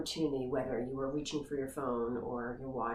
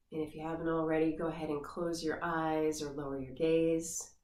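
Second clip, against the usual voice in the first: guided meditation. Acted. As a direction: ease the listener into the body scan gently. Leads guided body scan meditation.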